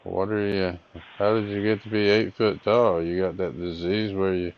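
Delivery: ordinary talk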